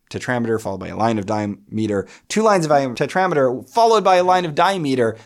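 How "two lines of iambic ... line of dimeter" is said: fast, higher, building louder; insistent repetition. Real, not acted. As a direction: rattle it off emphatically to drive the point home. Repeating pattern to hammer it home.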